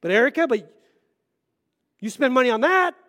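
mock defensive retort. To his wife Erica, a hammed-up defensive jab. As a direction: play the husband firing back at his wife.

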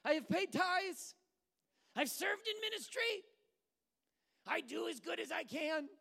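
high, loud mock tirade; frustrated with God. Believer listing good deeds to God, frustrated shouting. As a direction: shout each item as an aggrieved complaint. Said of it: "when we're frustrated with God".

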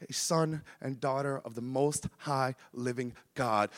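soft, heartfelt sermon close; hushed, reflective preacher's voice; tender preaching. Real, not acted. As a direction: bring it down to a quiet, sincere appeal. Soft sincere closing appeal.

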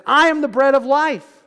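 proclaiming Jesus. Acted. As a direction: announce it boldly like Jesus.

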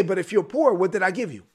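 ordinary talk, nothing marked

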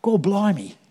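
a quick Cockney "Gor blimey"; brief stab at a London Cockney voice; Cockney accent. Retold or imitated. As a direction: drop into a Cockney exclamation. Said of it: "I'll try and put on my co-, Cockney accent".

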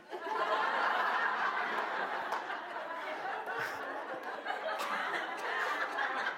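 breathless wheezing laugh; long breathy laugh. Real, not acted. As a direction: laugh helplessly at own joke.

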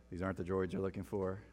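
lightly voiced Obi-Wan, low and soft robot tone; a hint of a calm Jedi mind trick; mock robotic Obi-Wan. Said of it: "use the robot voice when you do Obi-Wan Kenobi".

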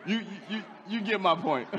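words broken by real laughter; preacher laughing at his own rooster impression. Real, not acted.

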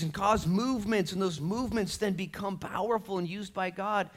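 high, loud, driving preacher voice; full-force preaching. Real, not acted. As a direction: pile up the list with rising force.

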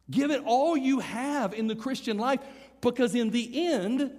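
high, loud, driving sermon delivery; forceful preaching. Real, not acted. Preacher urges church to finish what was started, forceful preaching.

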